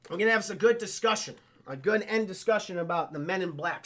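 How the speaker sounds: ordinary talk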